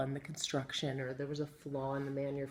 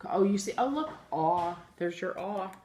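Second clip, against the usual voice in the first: normal talking voice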